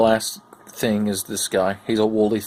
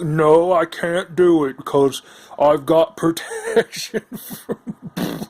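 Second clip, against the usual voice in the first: higher, slow, with a laugh; smug card character; 4 semitones higher, slower than usual. Retold or imitated. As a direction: refuse proudly, cracking up. Card voiced, far, laughing.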